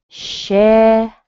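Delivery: "Sherry," loud and high on SHE with a tiny final e; teacher models her name; stressed first syllable. Shown as a demonstration. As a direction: punch the start of "Sherry,". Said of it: "has a little tiny e sound".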